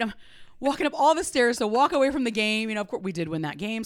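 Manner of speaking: ordinary talk, nothing marked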